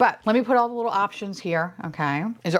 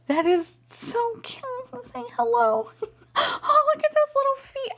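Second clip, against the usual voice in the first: high-pitched, squeaky cooing; real besotted gushing; adoring squeal. Real, not acted. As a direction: coo up high at a tiny cute thing. Cooing at toy.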